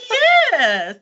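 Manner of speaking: laughing posh Melbourne yes; imitating the lady's posh reply, laughing; posh Toorak accent. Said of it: "And she said".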